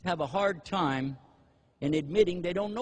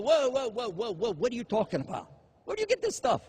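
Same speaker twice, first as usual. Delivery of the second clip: high, alarmed whoa, climbing higher; shocked objection; 5 semitones higher than usual. Retold or imitated. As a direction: stop him in disbelief. Shocked listener stops the answer.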